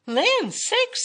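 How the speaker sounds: high, loud mama bear exclamation; middle-sized Mama Bear voice. A narrator's reading. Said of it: "said Mama Bear in her middle-sized voice".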